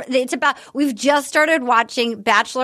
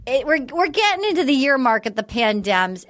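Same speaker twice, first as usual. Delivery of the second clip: usual speaking voice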